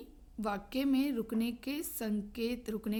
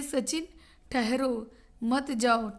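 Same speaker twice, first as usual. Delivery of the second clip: drawn-out "ठहरो", break, then "मत जाओ"; pause after ठहरो; slower than usual. Shown as a demonstration. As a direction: slowly stop on "ठहरो" before saying "मत जाओ". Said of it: "ठहरो के बाद जो अल्प विराम आया है". (धीरे "ठहरो", विराम, फिर "मत जाओ")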